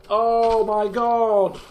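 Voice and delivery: slow, stretched Oh my god; drawn-out dismay. Real, not acted. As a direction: groan it out slowly. Realising red snapper binned.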